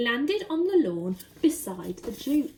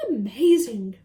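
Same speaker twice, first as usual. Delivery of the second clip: a loud amazed burst; amazed Duke; 8 dB louder, slower than usual. Acted. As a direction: blurt it out in wonder. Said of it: "said the Duke".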